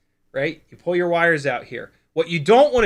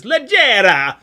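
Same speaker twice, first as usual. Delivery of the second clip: put-on Leggera said loud and higher in a blunt American accent; mock American accent; 6 semitones higher, 10 dB louder, slower than usual. Mocking how Americans say Leggera, in an American accent. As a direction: bark Leggera like a mocked American tourist. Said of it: "the American accent says".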